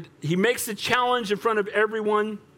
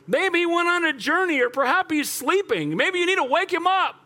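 high, ringing sarcastic taunt; Elijah mocking; 10 semitones higher than usual. Prophet tells rivals to rouse their god, mocking. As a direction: goad them with mock advice. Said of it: "Elijah mocked them".

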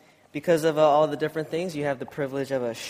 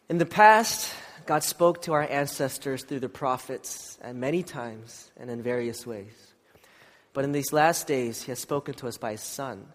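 scripture reading; 3 semitones lower, 6 dB quieter than usual. Acted. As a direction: read the passage reverently.

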